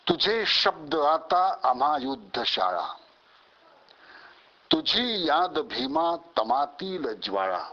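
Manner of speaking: rhythmic declamation, lines launched high then falling; ringing, metrical verse with pauses between lines; poem recitation. Acted. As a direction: recite it as a rousing battle poem.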